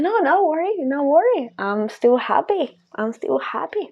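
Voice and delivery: playfully untouchable reassurance; mock cheerful reassurance. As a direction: float above it, singsong and unbothered.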